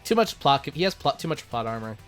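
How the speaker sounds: ordinary talk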